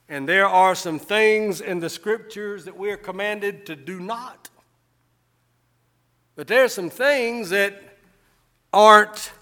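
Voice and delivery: loud, high, fervent pulpit preaching; a revival preacher at full cry; preaching at full force. Acted. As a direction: preach it out and lift them up. Pastor preaching to congregation.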